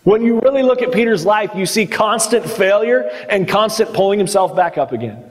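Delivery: ordinary delivery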